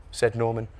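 plain reading voice

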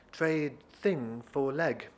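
very slow, spaced-out words; foreign woman's broken English. Acted. Woman offers trade for the leg, halting broken English. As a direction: spell out the bargain haltingly.

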